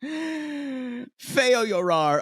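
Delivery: very slow "Feo llorar"; drawn out. Retold or imitated. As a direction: stretch it out slowly. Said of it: "I wrote down".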